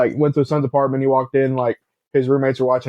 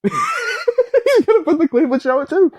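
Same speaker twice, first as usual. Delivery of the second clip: much higher, slow laughing cry; laughing shout; slower than usual. Real, not acted. Laughing at his cohost's ranking, high shouted laugh. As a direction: crack up while shouting the guess. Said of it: "I am".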